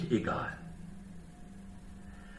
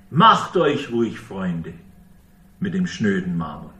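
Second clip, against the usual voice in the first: biting irony, with "Macht" pushed out louder; sarcastic; 5 semitones lower, 3 dB louder than usual. Shown as a demonstration. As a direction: say "Macht euch ruhig Freunde" as a sneering, sarcastic dare. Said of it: "Sarkasmus pur".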